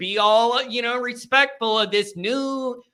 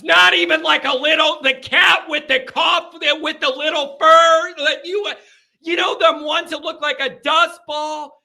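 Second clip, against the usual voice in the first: put-on higher, louder excited girlfriend; mock girlfriend; 5 semitones higher, 4 dB louder than usual. [name] telling John about the cats, mock excited. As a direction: gush about the fluffy cats.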